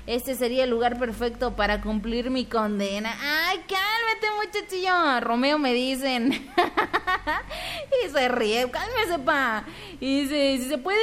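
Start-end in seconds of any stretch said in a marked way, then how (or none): 3.2-5.2 teasing scold. Acted. Teasing reaction to flirty line. 6.5-8.0 high giggle. Real, not acted. Laughs at flirty comment.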